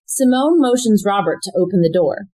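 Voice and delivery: ordinary talk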